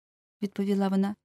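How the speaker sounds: ordinary delivery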